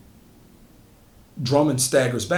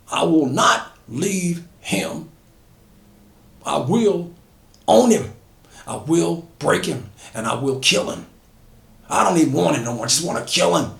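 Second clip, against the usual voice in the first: raised, seething vow to kill; 5 semitones higher, 4 dB louder than usual. Acted. Drummond refusing his partner, seething and raised. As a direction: spit every I will.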